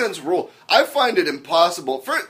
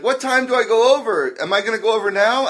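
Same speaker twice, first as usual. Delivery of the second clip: raised voice imitating an eager daughter; 7 semitones higher than usual. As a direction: pipe up as the daughter asking. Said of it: "she's like".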